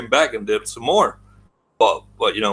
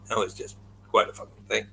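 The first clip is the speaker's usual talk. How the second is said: ordinary delivery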